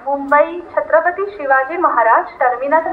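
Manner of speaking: normal talking voice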